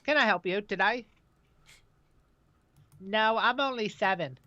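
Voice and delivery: high put-on child voice; squeaky fake voice to dodge a caller; fake phone voice. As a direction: pretend to be a little kid answering the phone. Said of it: "like, a fake accent".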